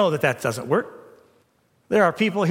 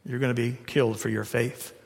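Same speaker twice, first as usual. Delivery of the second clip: lower, soft and close; tender Jesus; 5 semitones lower, 4 dB quieter than usual. Retold or imitated. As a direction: gently confide to Peter. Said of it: "had his arm around him and said".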